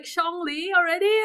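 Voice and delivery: high, incredulous question; angry disbelief. Retold or imitated. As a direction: demand it as if outraged by the news. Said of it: "And I'm so angry because".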